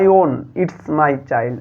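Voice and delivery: very slow, word by word; slow dictation. Shown as a demonstration. Teacher dictating a quote to pupils, very slowly. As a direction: read it out slowly so pupils can follow.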